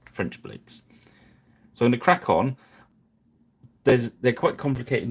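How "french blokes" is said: "French blokes" whispered softly to himself; whispered aside. Shown as a demonstration. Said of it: "I'll just call them the French blokes".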